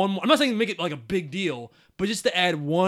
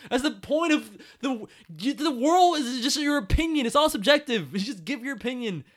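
ordinary delivery

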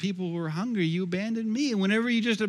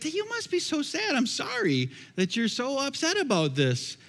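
pitying sympathy; 7 semitones higher than usual. Acted. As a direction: coo sympathy at an upset person. Answering the yelling world with pity.

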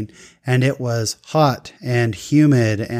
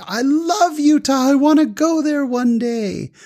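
very high, gushing excitement; Abdulia; 13 semitones higher than usual. Retold or imitated. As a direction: gush like an overjoyed driver, pitch way up. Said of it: "He got really excited".

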